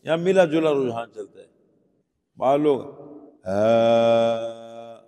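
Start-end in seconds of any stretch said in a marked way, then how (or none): 3.5-5.0 long 'ہا' with light open H. Shown as a demonstration. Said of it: "اس کو بھی پڑھتے ہیں".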